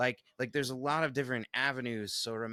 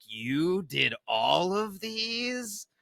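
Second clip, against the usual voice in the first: slow, high, marvelling question; retold amazement; 6 semitones higher, much slower than usual. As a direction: sound amazed someone painted them all. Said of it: "she was like".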